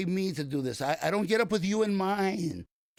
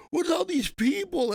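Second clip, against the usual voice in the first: put-on confused little boy; 12 semitones higher, 4 dB louder than usual. Crying son confused by fans.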